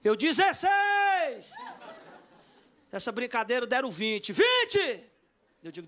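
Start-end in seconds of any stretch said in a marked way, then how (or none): 0.2-1.5 high, stretched mock auction call; acted long auctioneer yell; drawn-out bid shout. Calling out his own bid. 4.3-5.0 high-pitched mock bidding call; acted auction yell; retold bid shout.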